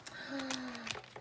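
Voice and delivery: soft, quick intake of breath; a real, quiet gasp of wonder. Real, not acted. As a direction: gasp softly at the card. Reacting to the card.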